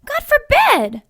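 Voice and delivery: high, loud scandalized gasp of words; shocked outcry. Acted. Nun scandalized by her sister's cruel wish. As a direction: cry out in pious horror.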